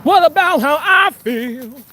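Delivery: slow, high mock soap-opera wail; mock lament. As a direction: ham up a tragic plea.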